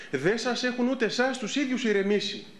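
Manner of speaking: ordinary delivery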